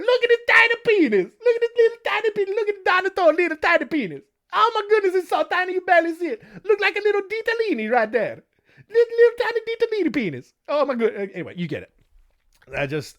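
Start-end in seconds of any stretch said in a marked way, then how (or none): 0.0-11.3 put-on high, loud cackling Italian accent taunt; hammed-up Italian accent aunt laughing as she mocks; aunt jeers at the boy in front of his cousin, high and laughing, Italian accent; mocking Italian aunt. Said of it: "I had to break out the Italian".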